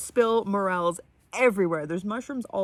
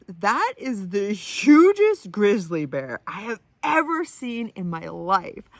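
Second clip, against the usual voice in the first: louder, stretched amazement at a huge sight; awestruck inner reaction; 5 dB louder than usual. Retold or imitated. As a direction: blurt it out, then slow right down. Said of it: "my first thought is".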